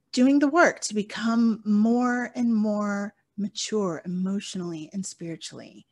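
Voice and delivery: usual speaking voice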